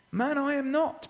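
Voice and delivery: firm denial, pitched high; bold denial. Acted. Peter denying he is a disciple, bold and high-pitched. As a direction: push back boldly, voice lifted high. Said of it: "been bolder and stronger with each one".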